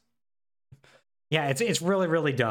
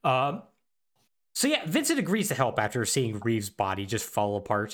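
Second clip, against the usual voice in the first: ordinary delivery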